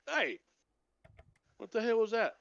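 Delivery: high-pitched affronted outburst; acted outrage at being squirted; indignant dwarf.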